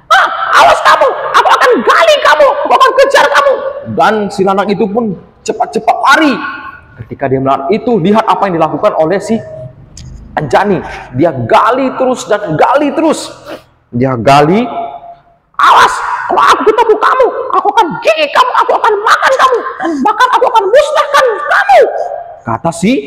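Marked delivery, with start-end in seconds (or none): 0.1-3.5 very high, loud threatening shout; furious squeaky creature yelling; angry threat. Acted. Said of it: "Ketika terus marah". 15.6-21.8 furious squeaky creature yelling; angry threat. Acted. Anjani threatens the porcupine.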